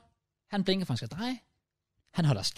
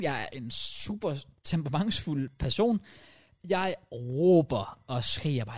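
ordinary delivery

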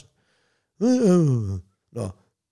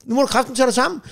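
stern rebuke; 8 semitones higher, faster than usual. Retold or imitated. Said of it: "der skal sige".

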